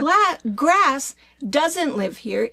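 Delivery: slow, loud, high and insistent; emphatic rant. Real, not acted. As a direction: hammer each word home. Rant continues.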